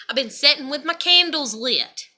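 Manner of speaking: normal talking voice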